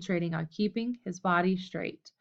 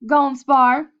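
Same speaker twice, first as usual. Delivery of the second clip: insistent demand; 5 semitones higher, 10 dB louder than usual. A narrator's reading. Red pushing to spar.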